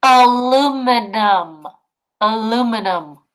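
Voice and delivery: aluminum drawn out slowly and loudly; slow, loud read. Shown as a demonstration. Teacher sounding out a word slowly for the class. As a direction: spell it out in sound for the kids. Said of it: "say it with me".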